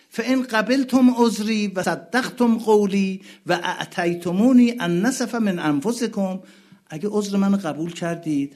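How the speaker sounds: loud, high pleading sermon voice; impassioned preaching. Acted. Preacher voicing Husayn pleading with the army, loud. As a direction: plead with the crowd.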